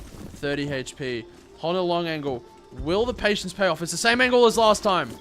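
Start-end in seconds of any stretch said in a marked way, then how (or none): 1.6-5.1 esports caster. Real, not acted. Live play-by-play builds to the kill.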